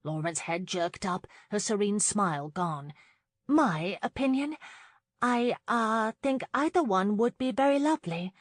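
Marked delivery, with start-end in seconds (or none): 3.5-8.3 high, hesitant and caught off guard; unsure young countess, higher-voiced; uncertain. A narrator's reading. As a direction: falter politely, pitched well up. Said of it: "she murmured uncertainly".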